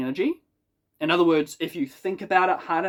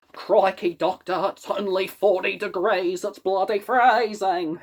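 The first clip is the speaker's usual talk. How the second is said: put-on broad, slow Australian accent; hammed-up Aussie drawl; 4 semitones higher, slower than usual. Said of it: "authentic Aussie".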